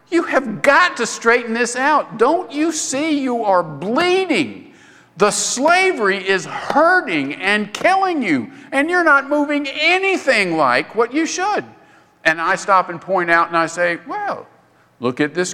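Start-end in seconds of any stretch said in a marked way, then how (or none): 0.1-11.6 higher, slowed urgent scolding; lightly voiced wife pressing him to act; Helen scolding. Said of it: "she would say things like".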